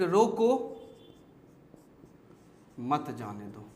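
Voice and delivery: "रोको" alone, long pause, then low "मत जाने दो"; pause after रोको. Shown as a demonstration. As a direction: break after रोको, run मत जाने दो together. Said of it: "के बाद कॉमा". (रोको के बाद ठहराव)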